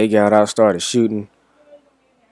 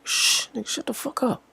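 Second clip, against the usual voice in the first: sharp whispered shush, much higher; hushed whisper; 9 dB quieter than usual. Retold or imitated. Friend hushing the narrator about guns, sharp whisper. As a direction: hiss at him to shut up.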